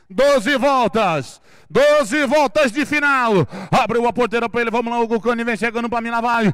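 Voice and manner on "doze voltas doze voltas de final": drawn-out announcer call. Real, not acted.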